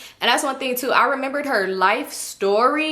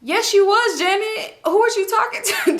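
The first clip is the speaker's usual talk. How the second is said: incredulous; 10 semitones higher than usual. Retold or imitated. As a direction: call her out, shocked. Calling out her lie.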